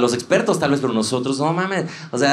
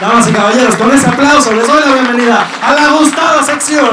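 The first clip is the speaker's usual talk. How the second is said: big shouted fanfare, last words stretched; show announcer; 12 semitones higher, 7 dB louder than usual. Acted. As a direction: hype the segment like a ringside host. Announces a comedy segment like a showman.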